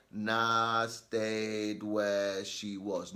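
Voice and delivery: very slow, dragged-out drawl; exaggerated slow reading. Shown as a demonstration. Teacher mocking an overly slow read-aloud, dragged out. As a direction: overdo the slowness as a joke. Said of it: "I'm not saying read it like".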